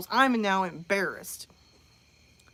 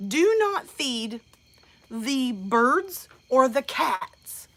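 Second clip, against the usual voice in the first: slow sign reading; much slower than usual. Retold or imitated.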